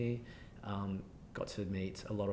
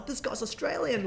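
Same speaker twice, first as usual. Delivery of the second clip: put-on high, delighted Australian accent discovery; surprised colleague; 14 semitones higher, 4 dB louder than usual. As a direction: squeak it out like a surprised boss. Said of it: "And he was like".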